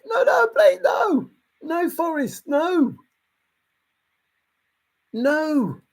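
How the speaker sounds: high, frantic begging with a cry; a hooked fish pleading, voice cracking high; desperate pleading. Acted. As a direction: beg Forrest not to let go.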